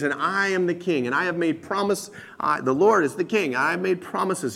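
ordinary delivery

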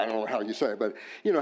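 ordinary talk

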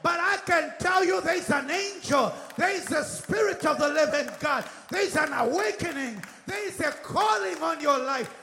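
driving, rousing sermon climax; full-force preaching. Real, not acted. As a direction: hammer each declaration at the congregation.

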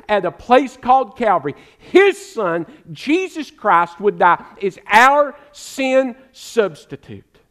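preaching at full force. Acted. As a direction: build the sermon to its peak. Preaching climax.

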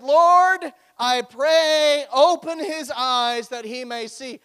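loud, high, impassioned preaching; fervent prayer. Acted. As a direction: call out to the Lord with force. Reads the prophet's prayer aloud.

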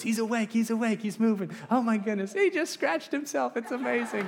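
very high, hushed-awe gossip voice, hammed up; excited villagers, mimicked. Villagers gossiping as the visitor wakes, mimicked high excited chatter. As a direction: squeal it like astonished onlookers.

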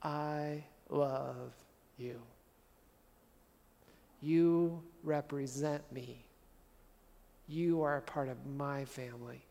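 soft, slow, tender Father's voice; a slow, gentle reassurance; loving Father. Retold or imitated. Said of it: "the Father says".

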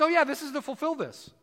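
a hint of a chatty angel; casual angel. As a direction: toss it off like an offhand angel. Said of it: "The angel says".

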